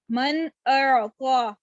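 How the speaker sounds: very slow من اعطا with a distinct n in من; clear noon. Shown as a demonstration. Teacher reads the example for students, slow من اعطا with clear n. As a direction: recite من اعطا drawn out, the n sounded clearly before the hamza. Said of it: "یعنی میں نے نون کو واضح کیا".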